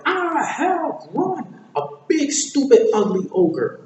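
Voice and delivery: loud, high cry of alarm; mock-horrified high shout; panicked onlooker. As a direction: scream the warning, acting terrified. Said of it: "people take one look at me and goes like".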